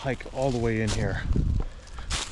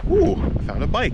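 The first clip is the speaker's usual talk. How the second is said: excited; 8 semitones higher, 4 dB louder than usual. Real, not acted. Finds a bike while hiking.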